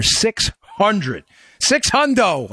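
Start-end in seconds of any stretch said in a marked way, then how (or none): 1.6-2.5 lightly voiced brother saying hundo; electrician brother slang. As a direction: pitch up, toss out a casual hundo. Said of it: "as my electrician brother would say".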